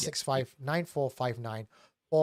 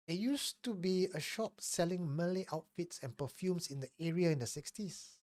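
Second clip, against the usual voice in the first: story narration; 3 semitones higher than usual. Acted. Reads a listener's confession.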